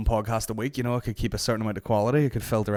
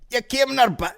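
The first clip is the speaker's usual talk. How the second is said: higher mock Scottish lad; mimicking Scottish guy; 14 semitones higher, 6 dB louder than usual. Quoting the man in the clip, mock Scottish. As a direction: parrot the Scottish guy's line. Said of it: "He's like".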